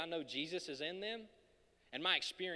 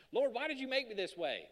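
mock complaint; 8 semitones higher, 4 dB louder than usual. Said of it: "I do that sometimes".

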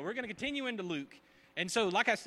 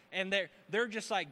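usual speaking voice

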